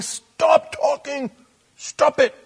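high, loud scolding teacher voice; exasperated teacher. Retold or imitated. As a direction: snap at the chatterbox from the front of the class. Teacher scolding chatty girl.

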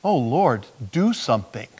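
soft, pleading little prayer; murmured plea to the Lord. Retold or imitated. Driving past, praying quietly to the Lord. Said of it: "Short little baby prayer".

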